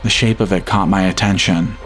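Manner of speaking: ordinary delivery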